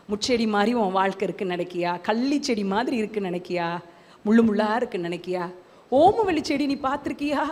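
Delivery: low, hushed, intimate questioning; soft retold question. Jesus asking the preacher about his life, hushed. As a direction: imitate an inner voice, softly and low.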